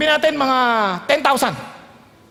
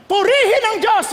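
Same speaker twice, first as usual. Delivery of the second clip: high-pitched shout of praise; crowd shouting; 8 semitones higher, 3 dB louder than usual. Acted. Crowd under Roman rule praising God, shouting. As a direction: call out praise over a noisy crowd. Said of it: "Yun ang sumisigaw sila".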